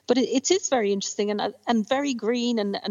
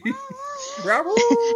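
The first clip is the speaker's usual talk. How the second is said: high laugh; 14 semitones higher than usual. Real, not acted. Laughing at impression.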